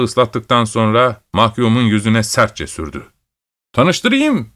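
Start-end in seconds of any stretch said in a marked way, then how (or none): 3.7-4.5 high ringing shout of triumph. A narrator's reading. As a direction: shout the introduction high and proud. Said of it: "diye bağırdı".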